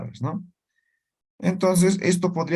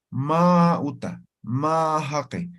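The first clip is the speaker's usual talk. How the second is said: slow demo form; slower than usual. Shown as a demonstration. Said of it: "Entonces digo".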